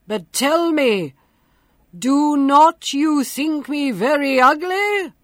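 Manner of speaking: loud, booming beast voice; grotesque monster voice. A narrator's reading. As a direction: boom it out like a monster. The monster speaks.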